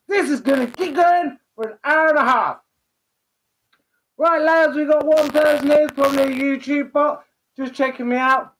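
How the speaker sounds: high, silly put-on presenter voice; stupid voice. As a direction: ham up a goofy host voice. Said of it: "get rid of the stupid voice now".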